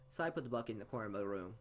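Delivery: usual speaking voice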